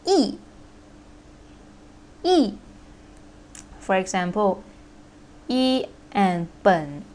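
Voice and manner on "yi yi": yi said high and falling; yi in fourth tone. Shown as a demonstration. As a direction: drop yi sharply in the fourth tone. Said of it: "yi need to change into".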